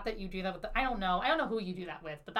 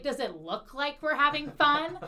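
loud, higher-pitched indignant retort; upset; 6 semitones higher, 6 dB louder than usual. Retold or imitated. Woman retorting to the guy's defending friend, indignant. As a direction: throw it back at them, indignant. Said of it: "I was so".

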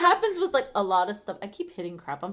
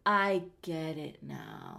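slow realization; 6 semitones lower, 4 dB quieter, much slower than usual. Retold or imitated. Friend voiced finally understanding.

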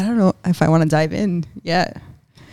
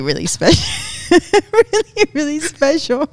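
giggling; 9 semitones higher, 3 dB louder than usual. Real, not acted. Giggling during the intro.

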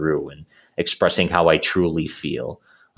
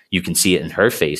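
usual speaking voice

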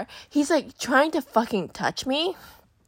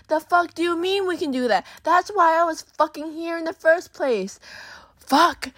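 high-pitched indignation, retold; exasperated outburst; 7 semitones higher than usual. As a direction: act out being fed up and incredulous. Retold outburst at date's evasion.

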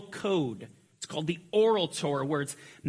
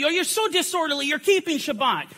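higher, quicker imitation of an imagined scolding; mock the rebuke Paul never gave; 8 semitones higher, 4 dB louder than usual. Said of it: "Notice he doesn't come and say".

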